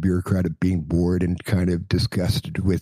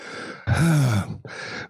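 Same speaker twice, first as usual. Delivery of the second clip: weary sigh; 5 semitones higher than usual. Acted. After the tirade.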